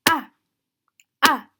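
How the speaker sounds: "Ah" like hitting the table; short, thudding fourth-tone "Ah"; sharp falling tone. Shown as a demonstration. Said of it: "if something is falling or you're hitting your table".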